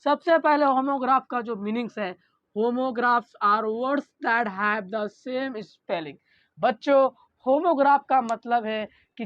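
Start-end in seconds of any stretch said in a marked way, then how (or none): 2.6-6.1 very slow, word by word for notes; slow dictation. Shown as a demonstration. Teacher dictating slowly to the class for notes. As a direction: read it out slowly so students can write. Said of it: "teacher dictates the definition".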